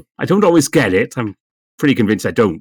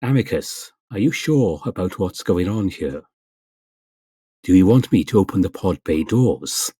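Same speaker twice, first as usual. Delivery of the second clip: measured, slightly lowered computer voice; Com, in the manner of HAL; 4 semitones lower, 3 dB quieter than usual. Shown as a demonstration. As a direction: lightly imitate a calm sci-fi computer addressing a crewmate. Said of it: "trying to think of how Hal would speak".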